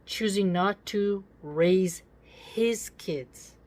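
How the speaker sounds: slow, leaning hard on choosing; mock-quoting her words slowly; mocking stress. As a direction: drag out choosing with scorn. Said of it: "how she phrased that".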